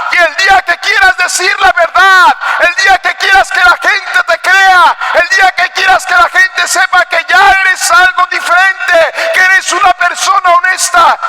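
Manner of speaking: high, forceful preaching shout; prédica a voz en cuello. Real, not acted. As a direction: preach it at full force, pitched high and sustained. Predica a toda fuerza. (predicar a gritos con fervor)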